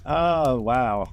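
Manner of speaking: ordinary talk, nothing marked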